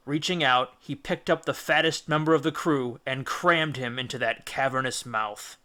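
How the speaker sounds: ordinary delivery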